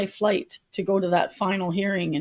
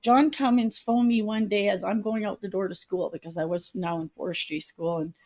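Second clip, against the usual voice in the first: normal talking voice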